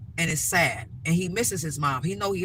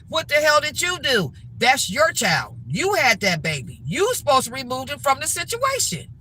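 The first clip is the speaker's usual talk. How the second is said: raised, emphatic telling-off; indignant scolding; 7 semitones higher, 4 dB louder than usual. Real, not acted. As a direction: lay into a negligent parent, loudly. Scolding negligent parents directly.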